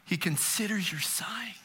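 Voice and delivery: soft whisper. Acted. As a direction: let it fall to a hush. Echoing the whispered psalm.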